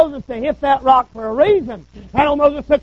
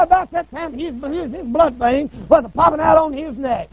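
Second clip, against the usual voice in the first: normal talking voice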